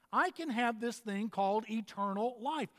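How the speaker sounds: ordinary talk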